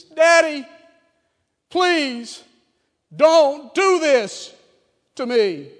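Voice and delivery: high, loud pleading cry, very slow on don't do this; loud, high, drawn-out plea; anguished plea. Shown as a demonstration. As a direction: beg your daddy in agony.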